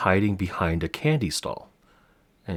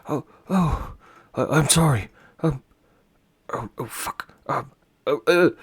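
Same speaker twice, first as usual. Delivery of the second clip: higher, stammering, rattled apology; flustered apology; 8 semitones higher, 6 dB louder than usual. Acted. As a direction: babble sorry in a panic. NPC flustered after scaring child.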